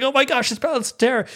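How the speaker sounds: high-pitched, fast fan excitement; ecstatic. Retold or imitated. Fan seeing Balance of Terror recreated, ecstatic. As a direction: geek out way up high, words tumbling. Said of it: "I was so ecstatic".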